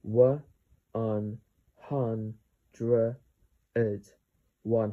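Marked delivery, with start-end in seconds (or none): 0.0-3.2 "One un hun dred" broken into isolated sounds; sounded out. Shown as a demonstration. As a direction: pause after each piece of "One un hun dred". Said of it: "How to pronounce this word?".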